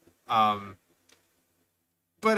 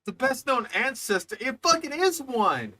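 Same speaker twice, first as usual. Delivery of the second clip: exasperated outburst; 6 semitones higher than usual. Real, not acted. Scolding the narrator's mistake.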